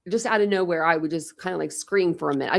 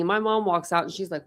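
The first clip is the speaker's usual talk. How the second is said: ordinary talk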